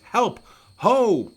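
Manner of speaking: high, loud acted cry for help; terrified scream. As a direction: scream out in terror as the queen. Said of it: "She screams".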